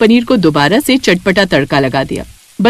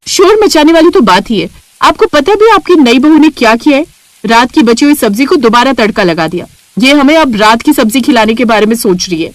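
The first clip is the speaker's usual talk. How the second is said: complaining sister-in-law; 2 semitones higher than usual. Acted. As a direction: tell on the new bride with outrage. (शिकायत करती जेठानी, ऊँची नाराज़ आवाज़)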